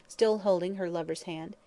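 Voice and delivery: ordinary delivery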